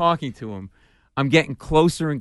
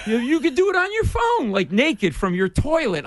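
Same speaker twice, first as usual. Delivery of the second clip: flustered babble; 9 semitones higher than usual. Retold or imitated.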